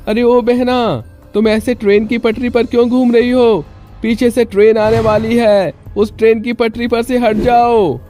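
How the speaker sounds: very high, urgent warning call. Acted. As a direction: call to someone far off on one high pitch. Man calls out warning from behind. (ऊँचे सुर में चेतावनी भरी पुकार)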